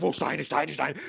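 hammed-up hunt for stones; mock frantic crowd. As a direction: repeat it like a crowd scrambling to throw. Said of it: "Haben die Steine und so".

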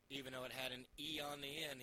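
normal talking voice

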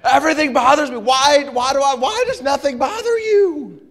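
high-pitched exasperated outburst, imitating an upset son; frustrated. Son demanding answers from his unbothered dad, frustrated. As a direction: act out a kid's loud, worked-up frustration. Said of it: "started to get a little frustrated".

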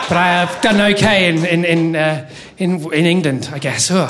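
ordinary talk

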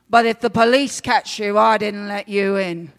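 loud, grumbly little man; grumbling warning. A narrator's reading. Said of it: "the tiny man grumbles".

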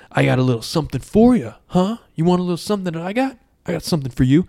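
acted teasing challenge; cocky trash talk. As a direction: needle the opponent with mock swagger. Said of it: "he starts talking shit to the troll".

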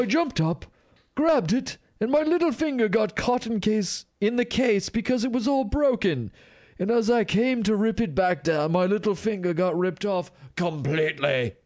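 high, loud upper-crust English, hammed up; upper-crust British accent. Host as teen raver telling how his finger tore off, upper-crust accent. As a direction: play it as a high-pitched upper-crust narrator. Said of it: "like, an upper crust, uh, type of accent".

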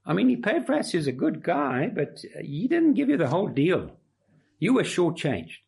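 a hint of a know-it-all teacher undermining Epaphras; mock false teachers. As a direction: sneer it like the smooth troublemakers. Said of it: "They're saying".

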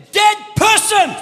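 high, slow, shouted call; shouted call to the dead; commanding call. Acted. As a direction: summon the dead. Jesus calling the dead.

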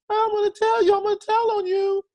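put-on high, fast whiny kid voice; tattling child. Preacher imitates a tattling kid, high and whiny. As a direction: squeal it like a child telling on a sibling. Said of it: "it's like they're tattling".

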